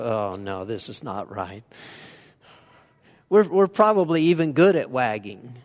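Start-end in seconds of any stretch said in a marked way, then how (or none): 0.0-1.6 a hint of a scornful onlooker; disapproving head-wag. Shown as a demonstration. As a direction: lower and softer, a disapproving tut. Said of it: "where you just go".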